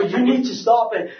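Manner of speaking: insist, re-enacting the confrontation; mock-urgent plea; retold insistence. Retold confrontation with store clerk.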